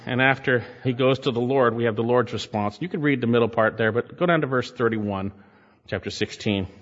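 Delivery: usual speaking voice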